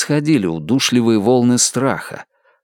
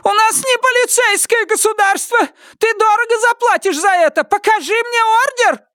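shrill shouting. Acted. Henrietta keeps shouting.

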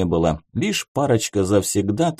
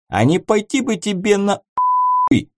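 angry; 11 semitones higher than usual. Acted. Angry retort in bar fight.

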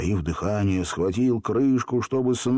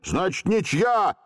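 high, drawn-out call; calling after them; 9 semitones higher than usual. A narrator's reading. Stepan Timofeevich calling after the runners, shouted. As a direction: call out to people leaving. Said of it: "крикнул им вслед".

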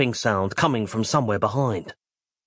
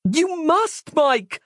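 very high, desperate plea; much slower than usual. A narrator's reading. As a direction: plead with him urgently, voice way up. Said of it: "Roz sensed the desperation in her own voice".

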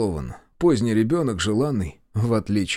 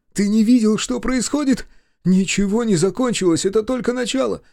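higher, louder heated protest; angry argument; 10 semitones higher, 4 dB louder than usual. A narrator's reading. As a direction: argue back furiously. Brother arguing angrily.

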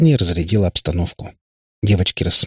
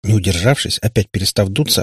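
ordinary delivery, nothing marked

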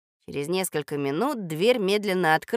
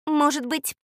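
unsure; 8 semitones higher than usual. Acted. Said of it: "неуверенно сказала Катя".

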